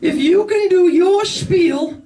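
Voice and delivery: put-on very high, slow prim old lady; prissy old woman spelling the swear out slowly; heckling granny demanding a clean set. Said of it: "she goes".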